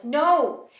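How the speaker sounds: high, loud rant that keeps escalating; frantic, angry shouting; panicked yelling. A narrator's reading. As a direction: yell in rising panic to a sarcastic finish. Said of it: "Stormy yells".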